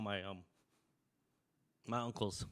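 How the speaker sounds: quiet, choked up; holding back grief; emotional. Real, not acted. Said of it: "I got a little emotional".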